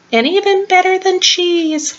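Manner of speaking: high, squeaky little mouse; tiny mouse voice. Acted. Squeaky praises the pancakes.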